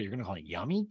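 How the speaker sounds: ordinary talk, nothing marked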